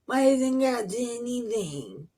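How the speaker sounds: high-pitched whining imitation; mock complaining. Imitating the people who complain to Moses, mock whine. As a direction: complain like a put-upon crowd, mockingly. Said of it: "they want to complain to him".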